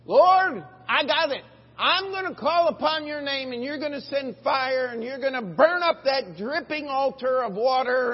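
loud, high sudden idea; a high, excited hammed-up eureka; mock eureka. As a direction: burst out like you've cracked it. Imagined Elijah's eureka.

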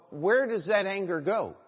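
ordinary talk, nothing marked

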